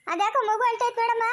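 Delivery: high-pitched call across the house. Acted. Alerting a woman that the baby is crying, called out high. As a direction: pitch it up to summon someone.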